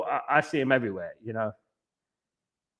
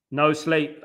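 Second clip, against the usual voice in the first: ordinary delivery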